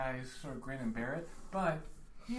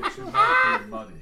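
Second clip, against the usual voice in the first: sudden high shout; 14 dB louder than usual. Acted. Plant character bursting out about mud in a sudden high shout. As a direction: jump way up and shout it out.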